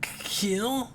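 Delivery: stammering whisper, voice gone high with fear; startled, breathless whisper; frightened whisper. Acted. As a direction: gasp it out under your breath, scared. Rival threatens to kill him.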